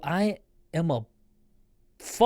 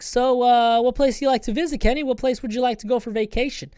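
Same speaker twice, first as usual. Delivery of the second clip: higher, louder, sing-song nurse chatting up a patient; mock sweet nurse; 8 semitones higher, 5 dB louder than usual. Nurses distracting the patient before sleep, sing-song. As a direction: coax the patient along like a playful nurse. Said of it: "they're like".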